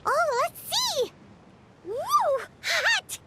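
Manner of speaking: excited yelps. Acted. Handling the hot core, excited yelps. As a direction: gasp at the heat.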